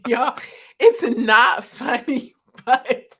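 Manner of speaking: trying not to laugh but failing; losing the straight face, giggling. Real, not acted. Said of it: "I hope I can keep a straight face".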